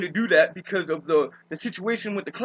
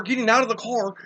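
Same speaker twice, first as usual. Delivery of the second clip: normal talking voice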